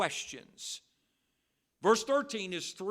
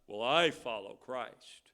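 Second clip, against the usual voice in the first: lower, slow, self-righteous retort; a hint of smug indignation, slowly; indignant Pharisee; 6 semitones lower than usual. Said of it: "some indignant Pharisee said".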